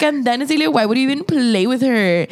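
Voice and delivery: high-pitched, exasperated retold question; incredulous parent questioning. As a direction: question the kid in mock disbelief. Acts out questioning the child.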